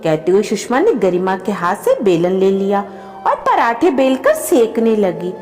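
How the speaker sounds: usual speaking voice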